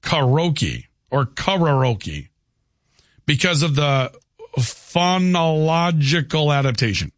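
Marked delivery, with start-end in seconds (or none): 0.0-2.3 karaoke very slowly as "kah-ROH-key", then "kah-rah-OH-key"; Japanese karaoke. Shown as a demonstration. Host reading the original Japanese, slow karaoke as "kah-rah-oh-key". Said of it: "instead of the original Japanese". 4.6-6.5 phonological slowly, syllable by syllable; phonological sounded out. Real, not acted. Host reading an AI answer, phonological slowly sounded out. Sounding out an unfamiliar technical word.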